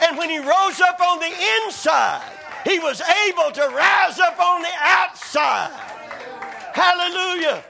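high, loud, fervent preaching; full-force preaching. Real, not acted. Preacher telling the congregation healing starts inside, full-force preaching. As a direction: drive it home at full voice.

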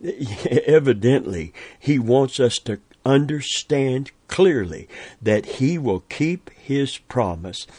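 ordinary delivery

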